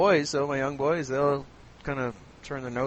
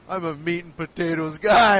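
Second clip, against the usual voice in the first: lightly mocking meat-lover voice, louder; mocking meat eater; 3 semitones higher, 7 dB louder than usual. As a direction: push it out as a mock meat-and-potatoes man. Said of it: "you just started mocking the meat eaters".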